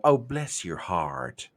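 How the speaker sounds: slow, low drawl; Southern drawl. Shown as a demonstration. Showing how to say it with that much drawl. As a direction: stretch it out like a Southern lady. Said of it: "say it with just that much drawl".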